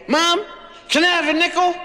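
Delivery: put-on high, loud little boy's plea; a child's high begging voice; begging kid. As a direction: wheedle like a small child after money. Kid begging mom for nickel.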